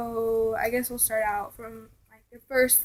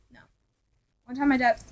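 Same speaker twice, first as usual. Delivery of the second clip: ordinary delivery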